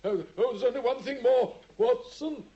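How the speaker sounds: high, loud urgent call; high pleading cry to Watson; desperate plea. Acted. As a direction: call him back in a sick man's panic. Desperate Holmes calling Watson back.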